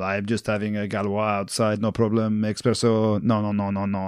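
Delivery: mock French accent, low and nonchalant; Romain Poite. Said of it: "what about Romain Poite the weekend?".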